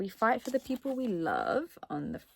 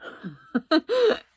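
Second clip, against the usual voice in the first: high bright giggle; embarrassed laugh; 9 semitones higher, 5 dB louder than usual. Real, not acted. Laughs at cat fur.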